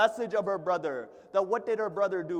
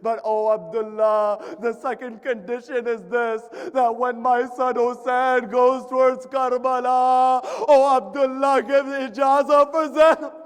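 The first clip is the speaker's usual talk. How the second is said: full-force mourning recitation; 6 semitones higher, 10 dB louder than usual. Acted. Preacher recounts Karbala to mourners, loud impassioned lamentation. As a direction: cry out each phrase as an impassioned lament.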